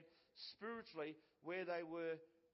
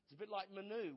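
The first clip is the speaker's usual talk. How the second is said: normal talking voice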